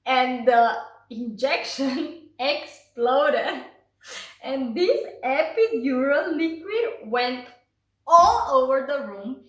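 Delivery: loud, laughing retelling; can barely get it out for laughing; laughing while telling. Real, not acted. Mother recounting the exploding epidural, laughing loudly.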